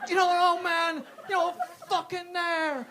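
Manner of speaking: high, very loud, stretched-out yell; traumatised shouting. Acted. Comic reliving the air-con at the door, anguished shout. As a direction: bellow it like a haunted veteran. Mock traumatised veteran, second outburst.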